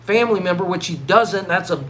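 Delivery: usual speaking voice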